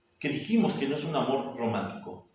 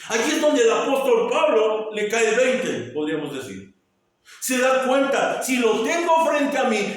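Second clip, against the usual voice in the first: much higher, louder preaching climax; climactic preaching; 9 semitones higher, 6 dB louder than usual. Acted. Preacher proving Christ just by the resurrection, climactic preaching. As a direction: drive it home at the peak.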